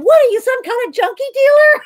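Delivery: high, loud comic outburst; mock outrage. Thwarted pen shopper to the store clerk, mock-outraged. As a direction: echo the comedian's indignant punchline. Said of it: "I felt like saying".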